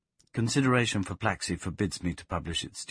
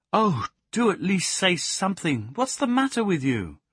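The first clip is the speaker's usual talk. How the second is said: much higher pleading voice; anxious pleading; 10 semitones higher, 4 dB louder than usual. Acted. Plaxi pleading with her silent dog. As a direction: press him anxiously.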